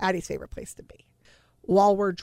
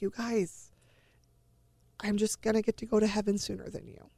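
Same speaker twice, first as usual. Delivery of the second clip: softer, understated imitation of a stoic child; retold stoic child; 2 semitones higher, 6 dB quieter than usual. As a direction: quote the child softly, without upset. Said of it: "she's just kinda stoic".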